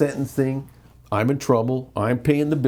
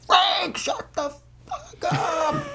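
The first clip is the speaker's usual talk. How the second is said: high, screamed outburst at her husband; a wife shrieking at her husband across the steps; retold yell; much slower than usual. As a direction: scream it at him from down the walkway. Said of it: "Shell, yelling".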